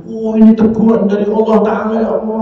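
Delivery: high, urgent mock cry to God; imitated tearful remorse. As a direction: act out sudden realization, pleading. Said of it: "Langsung nangis".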